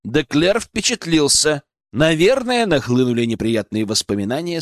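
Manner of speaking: plain reading voice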